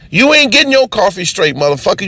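ordinary delivery

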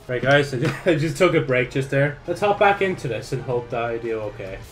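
ordinary talk

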